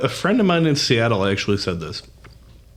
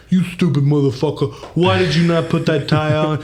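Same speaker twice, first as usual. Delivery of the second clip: higher-pitched mock-angry scolding; mean Boston guy; 4 semitones higher than usual. Boston stranger berating a stranded driver, mock anger. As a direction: chew them out like an exasperated Bostonian. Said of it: "They'll be mean about it".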